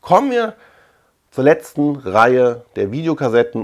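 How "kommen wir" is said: usual speaking voice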